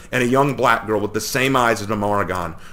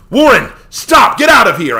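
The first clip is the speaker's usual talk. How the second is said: much higher and louder yell; shouted; 13 semitones higher, 11 dB louder than usual. A narrator's reading. Tomoki shouts at Warren to flee, loud shout. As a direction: yell him away from danger. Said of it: "I shouted".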